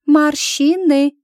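high, loud, drawn-out pronouncement; weighty, slow revelation; meaningful. A narrator's reading. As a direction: stretch it out with great significance. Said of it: "многозначительно произнесла Милаша".

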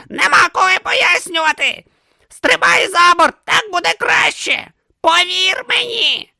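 high, loud urgent pleading; urgent shouting. A narrator's reading. Om urging Brutha to jump, loud and urgent. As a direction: shout it, desperate and fast.

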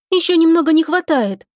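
high-pitched apologetic answer; guilty reply. A narrator's reading. Lika answers the captain about speed, guilty and apologetic. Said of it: "виновато ответила Лика".

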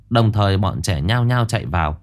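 plain reading voice

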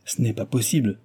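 ordinary delivery

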